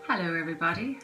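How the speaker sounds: ordinary talk, nothing marked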